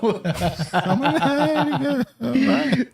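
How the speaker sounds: high, helpless laughing voice. Real, not acted. Cracking up at a co-host's Naughty Girl joke, laughing. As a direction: talk through a rising laugh.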